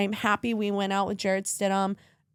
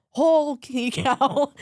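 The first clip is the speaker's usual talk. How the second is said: laughing; 4 semitones higher, 5 dB louder, much slower than usual. Real, not acted. Laughing through tears.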